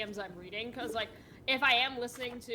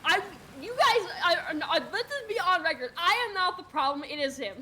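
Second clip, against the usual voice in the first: loud, high, amused indignation; raised-voice, exasperated self-defence; indignant protest; 7 semitones higher, 6 dB louder than usual. Real, not acted. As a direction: protest your innocence, half laughing. Protesting blame, half laughing.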